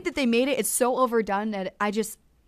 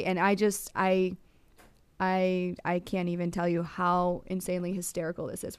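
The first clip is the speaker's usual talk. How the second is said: usual speaking voice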